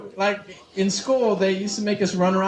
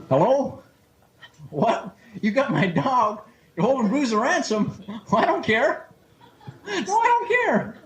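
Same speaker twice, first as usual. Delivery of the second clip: indifferent farmer; 7 semitones higher than usual. Acted. Farmer on phone with kidnappers.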